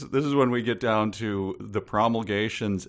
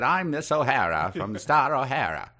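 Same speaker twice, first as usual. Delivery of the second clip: put-on New England accent; 5 semitones higher than usual. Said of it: "her English intonation resembled the New England accent".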